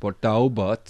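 Port Talbot accent. Shown as a demonstration. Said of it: "I can't do the accent".

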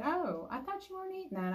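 high, quick quoted friend; lightly voiced questioning friend. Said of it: "someone who said".

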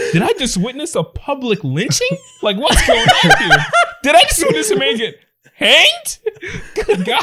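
put-on high, shocked kid voice rising; shrill disbelief, laughing through it; horrified kid. Imagined kid witnessing lynching.

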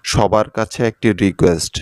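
ordinary talk

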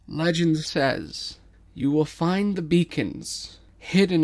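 slow, loud, chanting legend; solemn recital. Acted. The people telling King the legend, slow solemn chant. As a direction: intone it like sacred lore.

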